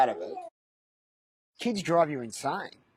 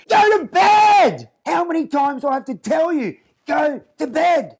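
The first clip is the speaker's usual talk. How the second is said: put-on a little girl yelling the order at the top of her lungs; toddler screaming at teddy; 12 dB louder than usual. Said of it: "and then screams".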